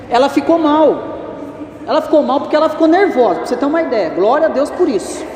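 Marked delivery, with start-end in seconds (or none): none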